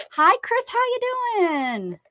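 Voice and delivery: high, delighted hello, stretched out slowly; excited greeting. Real, not acted. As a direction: call out a thrilled welcome to a friend. Excited greeting to a new viewer.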